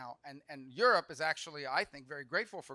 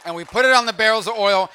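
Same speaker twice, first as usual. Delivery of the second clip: louder punchline; 6 semitones higher, 21 dB louder than usual. Shown as a demonstration. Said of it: "punchline repeated".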